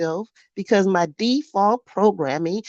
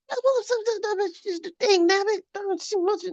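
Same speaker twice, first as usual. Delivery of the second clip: high angry cursing; 14 semitones higher than usual. Real, not acted. Cursing the beliefs.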